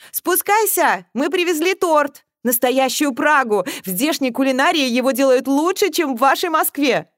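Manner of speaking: high, loud, cheery call. A narrator's reading. Mother invites daughter down for cake, calling out. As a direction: holler an invitation up the stairs.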